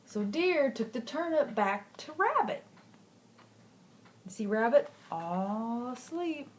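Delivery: plain reading voice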